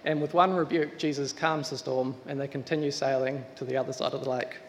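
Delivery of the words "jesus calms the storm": ordinary talk, nothing marked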